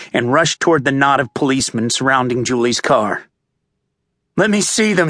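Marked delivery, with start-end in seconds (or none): 4.4-5.1 raised, urgent shout. A narrator's reading. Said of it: "he shouted".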